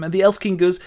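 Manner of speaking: plain reading voice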